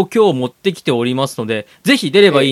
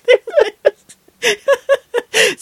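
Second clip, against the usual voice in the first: high, slow, dissolving into laughs; can't finish for laughing; 5 dB louder, much slower than usual. Real, not acted. Laughing breakdown.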